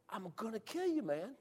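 very soft exasperated threat; hushed threat. Retold or imitated. Hunter fed up with shushing buddy, hushed threat. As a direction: mutter the threat so the deer won't hear.

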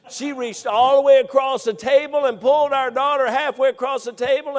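loud, high building retelling; rising dramatic narration; dramatic storytelling. Real, not acted. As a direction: paint the shock of it. Dramatic retelling of wife's act.

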